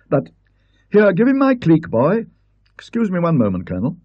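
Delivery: high, brisk scholar calling to the caddie; a fussy don giving orders; Parkins. Acted. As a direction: Parkins handing club to caddie. Parkins voiced by the narrator.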